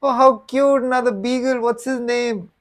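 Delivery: mock-sweet woman gushing over a beagle; cooing lady. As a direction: gush like a dog-loving lady. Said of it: "this lady is like".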